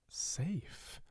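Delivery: breathless whispered disbelief; choked whisper; glugged. Acted. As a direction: barely get the word out. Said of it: "Bella glugged".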